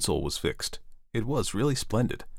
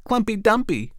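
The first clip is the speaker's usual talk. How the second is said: put-on children crying out; 14 semitones higher than usual. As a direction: call out the other choice. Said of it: "cried the others".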